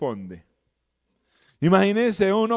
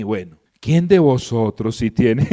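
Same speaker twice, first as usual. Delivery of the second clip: a lightly lowered, solemn voice for the Lord; 7 semitones lower, 4 dB louder than usual. Retold or imitated. Preacher voicing the Lord answering murmurers, lightly solemn. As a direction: speak as the calm Lord, unbothered. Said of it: "el tono del Señor así todo solemne".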